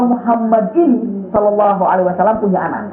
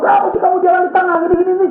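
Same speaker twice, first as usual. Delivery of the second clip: mock angry scolding; 12 semitones higher, much faster than usual. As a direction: retell his angry rant, hammed up. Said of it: "Marah dengan ngomong". (tirade marah yang ditirukan, tinggi dan cepat)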